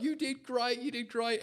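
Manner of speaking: high reassuring voice; imitating a comforting parent; mock reassurance. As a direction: comfort him gently. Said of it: "And I was like".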